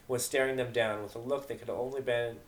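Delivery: usual speaking voice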